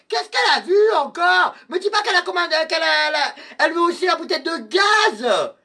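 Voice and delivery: personnage criard, plus aigu et plus fort; Père Brigitte. Acted. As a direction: crie et s'indigne contre la cliente. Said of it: "c'était le Père Brigitte". (le Père Brigitte engueule les clientes, voix aiguë criée)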